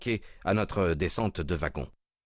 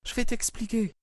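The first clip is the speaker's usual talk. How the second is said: much higher calm woman's voice; wife's voice. A narrator's reading. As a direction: answer him steadily as the wife. Wife enters, answers husband. (voix de l'épouse, haute et posée)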